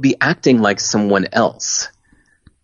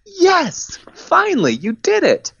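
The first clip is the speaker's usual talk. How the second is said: relieved cheering; slower than usual. Retold or imitated. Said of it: "they were like".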